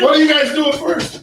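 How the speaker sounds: shouting. Real, not acted. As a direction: call out across the room. Calling across the room.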